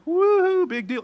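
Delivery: high sarcastic whoop, then dismissive; mock cheer. Preacher voicing people who gloss over Scripture, mock cheer. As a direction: whoop loudly, then shrug it off. Acting out an unimpressed reaction.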